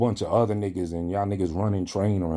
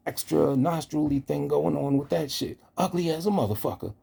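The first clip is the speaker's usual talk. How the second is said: mocking impersonation; 5 semitones higher than usual. Voicing the viewer mocking herself.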